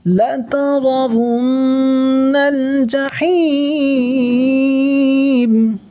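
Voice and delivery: slow, high melodic tajweed chant; slow sung Quran recitation; Quranic recitation. Shown as a demonstration. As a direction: draw out each word in recitation. Teacher chants Quran verse.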